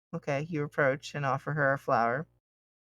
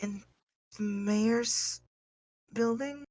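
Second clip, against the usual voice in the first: high, slow, unsure voice; hesitant small voice, trailing up; nervous young woman; 7 semitones higher, 4 dB quieter, much slower than usual. Acted. NPC girl answering.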